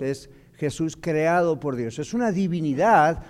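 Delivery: usual speaking voice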